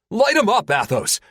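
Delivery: high, loud battle call; urgent shout. Acted. Pilot yells at his gunner to open fire, loud.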